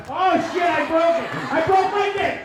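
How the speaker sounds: loud, high alarmed cry; mock panic, loud and high; panicked yelp. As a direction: shout it like you just snapped it. Panicked cry over broken toy.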